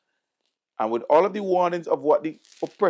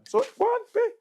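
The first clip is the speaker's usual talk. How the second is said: scornful dismissal; faster than usual. Real, not acted. Mocking students who wanted change.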